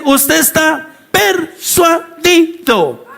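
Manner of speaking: PERSUADIDO drawn out syllable by syllable, pitched high; slow, high hammering of each syllable in persuadido; emphatic declaration. Real, not acted. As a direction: break persuadido into slow, separate beats. Preacher driving home the key word.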